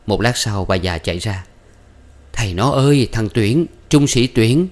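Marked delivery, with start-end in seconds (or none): 2.3-4.7 loud, high excited calling; excited mother. A narrator's reading. Mother rushing to tell her husband, loud and high with excitement. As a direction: burst in with the news.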